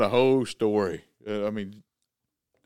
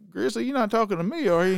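teasing question; 9 semitones higher than usual. Retold or imitated. Teasing quote to Grizzly.

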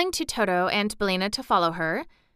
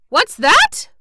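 much higher, louder wail; 13 semitones higher, 11 dB louder than usual. Acted. Mister Cinnamon Bun repeats himself, wailing higher. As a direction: climb the wail into panic. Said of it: "wailed Mister Cinnamon Bun".

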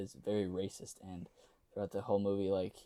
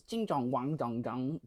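slow, mock gibberish Chinese; fake Chinese; 4 semitones higher, 4 dB louder, slower than usual. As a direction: babble fake Chinese syllables. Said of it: "talks in fake Chinese".

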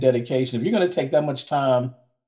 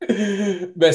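mock mournful echo; 3 semitones higher, much slower than usual. Echoes friend's answer in mock dismay.

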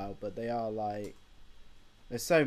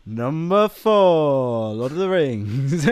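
bingo announcer; 3 semitones higher, 9 dB louder, much slower than usual. Pretend. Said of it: "bingo announcer".

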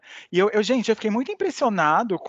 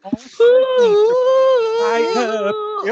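emotional squeal; 9 dB louder, much slower than usual. Acted. Overwhelmed by Rebeca's singing.

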